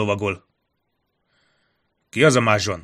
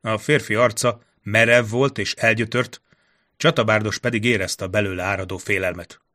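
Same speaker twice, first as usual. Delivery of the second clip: plain reading voice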